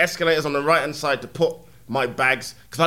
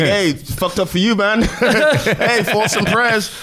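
higher, sarcastic, half-laughing concern; mock sympathy; 6 semitones higher, slower than usual. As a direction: fake caring to a robber. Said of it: "I'll be like".

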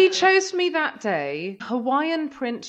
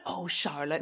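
soft, low recognition; lower, softer old lady; 7 semitones lower, 7 dB quieter than usual. Retold or imitated. A hint of the old caller recognising the granddaughter. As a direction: murmur the name fondly. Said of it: "She went".

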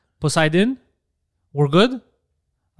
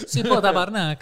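Quebec French; 3 semitones higher than usual. Retold or imitated. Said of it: "quelque chose comme ça".

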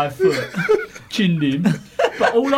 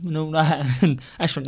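ordinary talk

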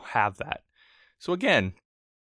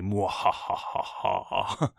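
evil laugh; 2 semitones lower, 3 dB quieter, much slower than usual. Pretend. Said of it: "since I'm so evil and cunning".

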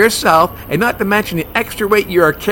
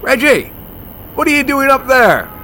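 calling out; 8 semitones higher than usual. Acted. Calling up to friend hanging.

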